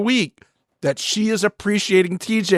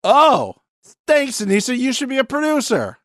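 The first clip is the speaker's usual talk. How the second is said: mock gratitude; 10 semitones higher than usual. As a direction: gush fake thanks in a raised voice. Mock thanks for her take.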